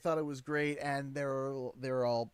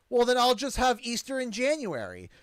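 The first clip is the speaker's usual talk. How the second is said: sarcastic retort; 5 semitones higher, 6 dB louder than usual. Retold or imitated. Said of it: "it took all of me not to say".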